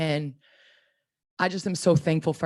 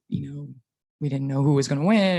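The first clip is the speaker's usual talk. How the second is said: normal talking voice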